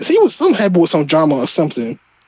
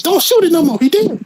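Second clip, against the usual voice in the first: quoting a movie line, pitched well higher; mock movie line, raised pitch; Harlem Nights quote; 10 semitones higher than usual. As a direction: play the panicked movie character, high. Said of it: "that scene in Harlem Nights".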